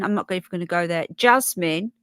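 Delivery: ordinary talk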